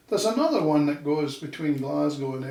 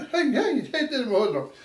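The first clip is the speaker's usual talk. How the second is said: high laughing Dundee sing-song; sing-song Dundee accent; 10 semitones higher than usual. Retold or imitated. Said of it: "more sing-songy".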